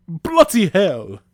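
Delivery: shouted British curse, high and slow; loud, drawn-out British outrage; British accent. A narrator's reading. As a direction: yell it in a put-on British accent. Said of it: "you and your British accent".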